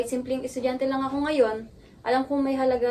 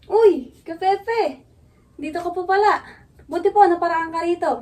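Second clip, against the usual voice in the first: higher, louder excited greeting; delighted surprise; 5 semitones higher, 7 dB louder than usual. Acted. Student greets Kuya Pepe dropping by, delighted surprise. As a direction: burst out in happy surprise at a welcome visitor.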